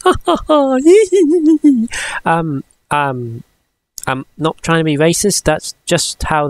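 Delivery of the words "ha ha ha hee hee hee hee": high, put-on tittering; mock giggling. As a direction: imitate a girlish giggle. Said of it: "they are very much".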